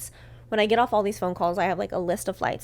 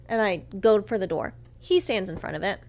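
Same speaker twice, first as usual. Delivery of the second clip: ordinary delivery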